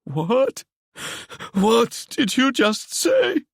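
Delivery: high, slow, tearful disbelief; almost crying. Acted. Ewan close to tears asking Seb to repeat it, high and slow. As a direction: voice near tears with shock. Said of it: "Ewan was almost crying when he asked".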